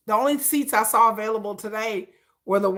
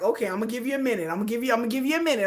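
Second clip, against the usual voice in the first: quick, soft rattled-off warning; rapid warning; 4 dB quieter, much faster than usual. Retold or imitated. Warning trolls in her comments, fast and soft. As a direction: fire it off fast and quiet. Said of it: "I'm like".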